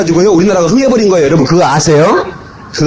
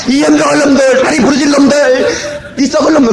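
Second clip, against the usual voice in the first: mock cursing; 7 semitones higher than usual. Villagers cursing invaders from hilltop.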